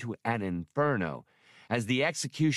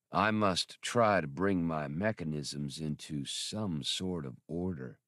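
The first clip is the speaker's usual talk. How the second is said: lower, weary robotic drawl; tired droid drawl; 5 semitones lower, 4 dB quieter than usual. A narrator's reading. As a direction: drag the words out, worn down. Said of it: "his drawl even slower now".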